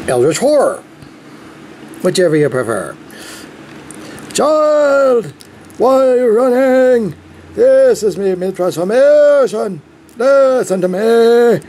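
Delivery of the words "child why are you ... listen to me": pompous alien warning. Pretend. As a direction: grandly warn the child of doom. Tobias voice bit again.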